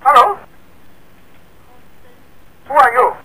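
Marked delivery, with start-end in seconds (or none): none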